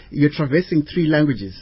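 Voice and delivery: ordinary talk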